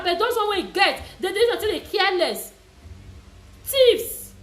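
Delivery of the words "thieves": high, loud condemnation of thieves; loud scornful cry. Acted. Vlogger condemning thieving hotel staff, loud scorn. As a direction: spit out the accusation loudly.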